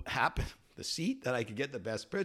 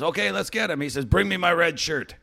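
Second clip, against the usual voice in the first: higher, louder commanding order; bold captain; 8 semitones higher, 7 dB louder than usual. Retold or imitated. Pirate captain ordering his crew, higher commanding call. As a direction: bark the orders like a fearless captain.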